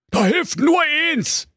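high squeaky brother, all worked up; excited high dwarf-tale voice. A narrator's reading. Wilhelm Grimm raises the alarm, excited and high. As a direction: yelp it out in alarm. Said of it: "rief der Wilhelm ganz aufgeregt".

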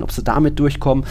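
usual speaking voice

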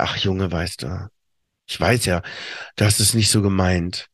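leise, tief und langsam beschwichtigend; sanfter Stiefvater. Retold or imitated. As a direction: wie ein milder Vater, der verzeiht. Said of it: "Und dann sagt er". (leise, tief und langsam, gütig zum Sohn)

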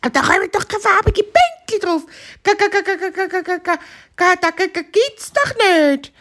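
very high clucking voice with ga ga stutters; clucking hen. Acted.

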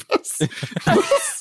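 breathless, half-laughing what; shocked disbelief. Retold or imitated. Stunned when guide says go alone, breathy laughing shock. Hearing guide wants to stay.

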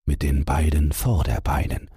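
ordinary delivery

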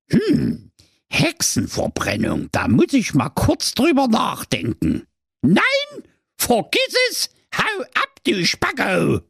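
spiteful witch hiss, rising to a very high shriek; hiss, then shriek her refusal; hissing angrily. A narrator's reading. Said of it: "Dann zischte sie böse".

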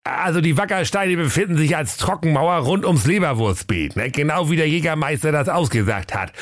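high-pitched reporting sidekick; comic knight-officer figure; Prinz Meisenherz. A narrator's reading. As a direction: report to the boss in a high put-on voice. Meisenherz continues his report.

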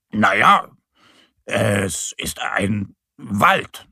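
slow, halting, unsure bear; drawn-out, faltering explanation; stammering bear. A narrator's reading. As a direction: hesitate between the words as Mo. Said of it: "stammelt Mo".

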